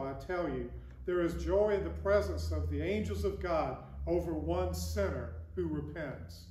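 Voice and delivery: usual speaking voice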